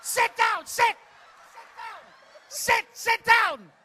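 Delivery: high, loud, repeated command; shouted order. Real, not acted. Host ordering the over-eager volunteer, loud. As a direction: bark them back to their seat.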